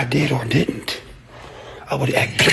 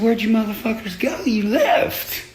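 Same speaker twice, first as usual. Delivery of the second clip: higher, teasing retold taunt; mock call-out; 9 semitones higher than usual. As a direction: call out to them with a mocking lilt. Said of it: "I said".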